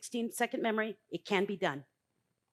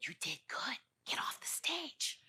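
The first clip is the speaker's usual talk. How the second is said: softer whispered reply, slow at the end; hushed, slow whisper; 12 dB quieter, slower than usual. Retold or imitated. Husband whispering to wife to leave the stage.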